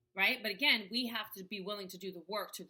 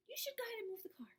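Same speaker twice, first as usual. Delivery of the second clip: whispered, high and quick; 14 semitones higher, 7 dB quieter, faster than usual. Shown as a demonstration. Intuition whispering a quick suggestion to move the car. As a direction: breathe it like a faint hunch. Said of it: "it seems so just like".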